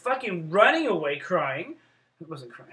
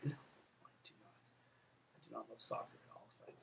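hushed whisper, far softer; whispered aside; 8 semitones lower, 24 dB quieter than usual. Real, not acted. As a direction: confess it under your breath. Confiding aside contradicting stated soccer love.

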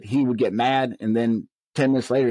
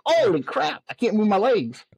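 high-pitched comic alarm; retold dismay; 9 semitones higher than usual. Winded lieutenant stuck at the steps, high comic dismay. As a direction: replay sudden disbelief, voice jumping way up. Realizing legs failed on the stairs.

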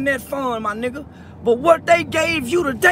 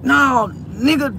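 usual speaking voice

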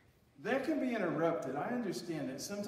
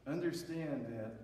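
normal talking voice

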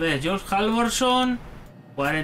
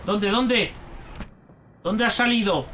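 normal talking voice

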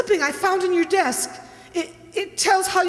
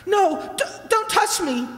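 frightened shout; 2 semitones higher than usual. Acted. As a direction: push him away in fear. Wife recoils from husband.

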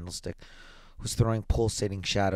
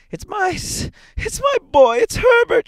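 very high, loud, stammering scream; frantic cry; 12 dB louder than usual. A narrator's reading. Mother held back from the door, frantic screaming. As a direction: shriek it while struggling to break free. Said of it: "she cried, struggling mechanically".

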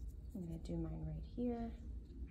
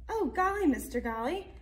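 put-on surprised child; 9 semitones higher, 8 dB louder than usual. Molly sees bus slide off.

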